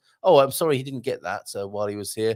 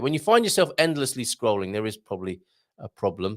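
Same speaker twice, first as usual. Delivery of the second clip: ordinary talk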